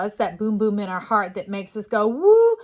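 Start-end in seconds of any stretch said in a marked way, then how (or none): 2.1-2.6 high, loud whoop of joy; excited woo. Acted. Heartbeat of passion makes her whoop out loud. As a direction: let a big woo burst out.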